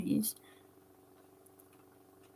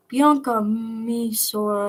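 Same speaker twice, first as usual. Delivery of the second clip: ordinary delivery, nothing marked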